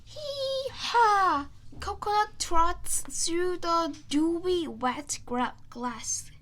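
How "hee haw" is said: loud high hee-haw bray; donkey bray. Acted. Narrator braying as the donkey Coconut.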